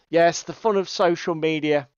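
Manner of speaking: ordinary talk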